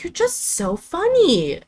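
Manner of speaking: high, slow sweet grandma voice; imitating grandma. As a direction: coo it like a doting grandma. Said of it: "She was like".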